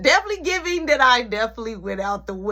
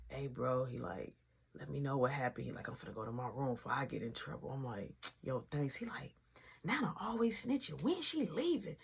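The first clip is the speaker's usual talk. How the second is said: much softer, low hushed voice; 7 semitones lower, 13 dB quieter than usual. Retold or imitated. Brother sneaking a word through the door, hushed and soft. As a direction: keep it down so grandma won't hear.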